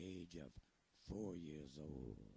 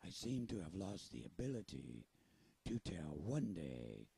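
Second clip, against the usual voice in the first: pirate voice; 5 dB louder than usual. Acted. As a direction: talk like a salty pirate storyteller. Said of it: "Do you think I sound like a pirate?".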